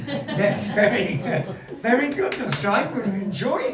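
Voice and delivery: high, loud, tickled congratulations; gleeful praise. Real, not acted. Host congratulating Nostra on the pun, gleeful and loud. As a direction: reward the pun with a burst of glee.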